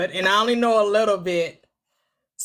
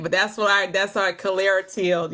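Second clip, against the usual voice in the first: usual speaking voice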